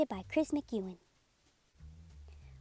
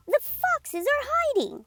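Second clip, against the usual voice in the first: much higher, thrilled secret; excited dog; 14 semitones higher, 4 dB louder than usual. Acted. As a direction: announce it with delight. Dog speaks.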